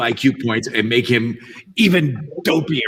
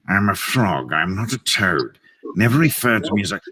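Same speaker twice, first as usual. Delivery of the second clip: haughty frog correcting you; snooty frog; 3 semitones lower than usual. Acted. Superior frog refusing to be called a toad, snooty. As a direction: look down on them with snooty precision. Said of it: "looks like he thinks he's better".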